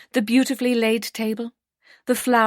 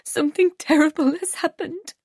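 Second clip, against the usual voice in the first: sobbing; 8 semitones higher than usual. A narrator's reading. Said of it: "she sobbed".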